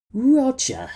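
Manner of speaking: loud high shocked cry of the name; shocked exclamation. A narrator's reading. Captain shocked at Roger's rudeness, loud cry. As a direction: call out his name in disbelief. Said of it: "he exclaimed".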